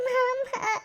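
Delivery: high-pitched sobbing call; crying. Acted. Niece asked why she cries, sobbing. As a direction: wail for uncle through sobs. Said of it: "எதுக்கு அழுகுற?".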